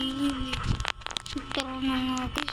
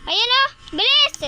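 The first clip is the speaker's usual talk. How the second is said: urgent shout. Real, not acted. Urging friend to hurry.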